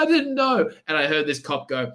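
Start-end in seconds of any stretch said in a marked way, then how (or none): 0.0-0.7 put-on panicked girl. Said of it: "And she's like".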